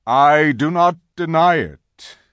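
slow, loud, solemn lion. A narrator's reading. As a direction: admit it with slow weight. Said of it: "said Aslan".

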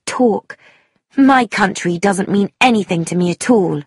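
high, impassioned, heavily stressed speech; vehement young woman. Acted. Young woman railing against patriotism, vehement. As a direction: argue hotly, hammering each phrase. Sheila argues passionately.

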